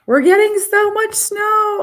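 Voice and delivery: high, loud, thrilled; excited about snow. Real, not acted. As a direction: show off the snowfall with delight. Showing snow on camera.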